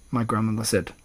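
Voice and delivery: plain reading voice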